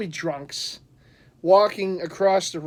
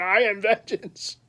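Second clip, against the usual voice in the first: hammed-up Batman; 3 semitones higher than usual. Imagined Batman answering yelling drunks, hammed-up menace. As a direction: play a menacing movie vigilante for laughs. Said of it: "You imagine them yelling".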